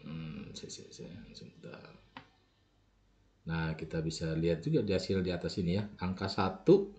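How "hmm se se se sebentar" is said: very soft, half-voiced mumble; barely audible thinking aloud; muttering to self. Real, not acted. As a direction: mutter under your breath while searching the screen. Talking to self while operating software.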